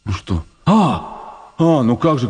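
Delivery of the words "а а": normal talking voice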